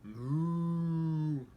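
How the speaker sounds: drawn-out cow moo; lightly voiced moo. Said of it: "said Cow".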